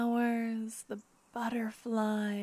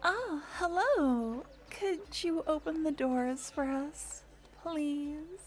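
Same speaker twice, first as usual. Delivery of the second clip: polite public voice; 5 semitones higher than usual. Acted. Polite greeting to the guards.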